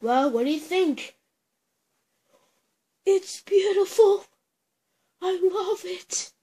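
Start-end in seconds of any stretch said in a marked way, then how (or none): 3.1-6.3 thrilled toy-voice praise; excited gasp. Pretend. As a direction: gush over the new pet. Admiring the new pet.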